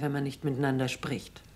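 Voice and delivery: usual speaking voice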